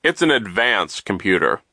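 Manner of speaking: loud, emphatic, self-satisfied adult voice; booming, swooping reassurance to children; pompous banker figure. A narrator's reading. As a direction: boast loudly about the school.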